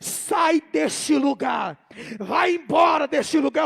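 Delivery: loud, drawn-out angry command. Retold or imitated. An angry voice in a dream ordering him to leave, angry shout. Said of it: "uma voz que estava com raiva".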